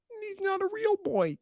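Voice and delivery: high, mocking cartoon voice; mock puppet voice. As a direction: sneer it in a Pinocchio-style squeak. Mocking Chili.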